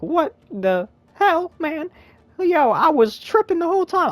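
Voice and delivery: high, slow falsetto disbelief; stunned falsetto. Retold or imitated. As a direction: stretch it out high like you're dumbstruck. Stunned by Cat Grant.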